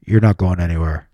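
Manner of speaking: low, menacing man on a recording; imitated deep male voice; man's voice. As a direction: drop deep for a stranger's voice. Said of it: "a man's voice goes".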